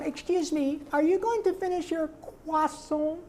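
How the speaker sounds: very high, far cartoon nerd voice; Carl's voice. Carl asking about a croissant, high whine. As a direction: ask it politely like a dorky kid. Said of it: "so when he said".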